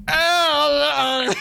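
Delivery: high, strained mock grunts of pain; hammed-up pained ow; mock pained groaning. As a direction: play a man groaning in pain as a joke. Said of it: "And he goes".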